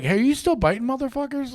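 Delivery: high-pitched, casual mock greeting; playful check-in. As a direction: call up an old fling, pitched high. Imagined friendly check-in with her.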